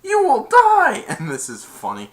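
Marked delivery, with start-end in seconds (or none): 0.0-1.0 very high, slow, loud yell; imitated furious threat. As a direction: bellow a death threat as the player. Retold death threat.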